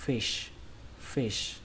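fish said with falling voice and an SH ending. Shown as a demonstration. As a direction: drop the voice on "fish", finish on sh. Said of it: "xuống giọng nó và kết thúc bằng âm sờ".

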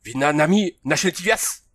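indignant high bellow; roaring. A narrator's reading. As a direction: roar in offence at being mocked. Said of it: "Rugit le cavalier".

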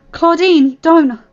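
loud, high plea to stop; urgent warning. Acted. Morgan restraining Claudine, warning urgently. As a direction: call out to hold them back.

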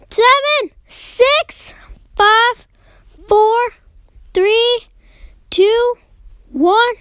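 high, spaced-out count; excited countdown. Real, not acted. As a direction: call each number out high, counting down. Tense countdown before the result.

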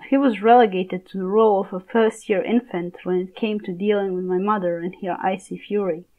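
ordinary delivery, nothing marked

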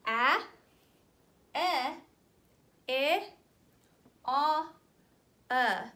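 each vowel sound said out loud and clear; firm, loud phonics sounds; vowel sounds demo. Shown as a demonstration. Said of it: "let's review our vowel sounds".

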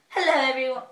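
put-on a light British accent on the greeting; a half-joking British hello. British accent greeting her viewers. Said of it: "I said that with, like, a British accent".

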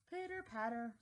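ordinary delivery, nothing marked

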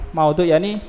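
ordinary talk

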